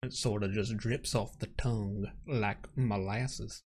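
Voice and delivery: slow, low, soft Southern Savannah drawl; Savannah accent. Pretend. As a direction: let the Savannah accent drip slowly like molasses. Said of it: "you wanna use the Savannah accent".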